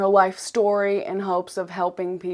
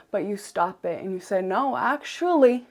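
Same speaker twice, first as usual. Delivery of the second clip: normal talking voice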